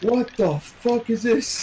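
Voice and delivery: high, slow mock outrage; angry office swan. Swan yelling at the boss in the office, high slow mock outrage. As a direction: squawk it like an outraged swan at work.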